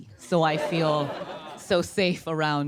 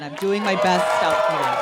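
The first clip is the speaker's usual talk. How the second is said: louder, proud declaration; shout to crowd; 2 semitones higher, 6 dB louder than usual. Real, not acted. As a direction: yell it out to the audience.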